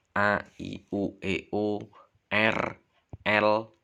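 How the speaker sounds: A, I, U, E, O, R, L each sounded out loud and clearly; clear articulation. Shown as a demonstration. Teacher drilling the class on the letters, loud and crisp. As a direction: spell the letters crisply, one by one. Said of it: "membaca dari lafalnya".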